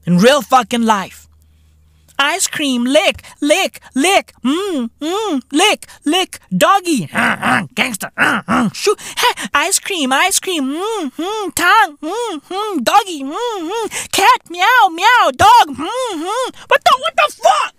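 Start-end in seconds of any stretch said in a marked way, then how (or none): 2.2-16.6 high, breathy NPC catchphrase moaning; NPC streamer impression. Mocking Pinky Doll's NPC stream with high moans. 16.7-17.7 high shocked yelp; shocked outburst. Real, not acted. Comedian reacting to the NPC stream in shock.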